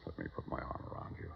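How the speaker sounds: soft, fast comforting whisper; tender whisper. Acted. Old man soothing his crying daughter, tender whisper. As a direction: hush her gently as you hold her.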